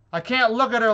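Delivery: ordinary talk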